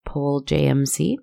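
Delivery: plain reading voice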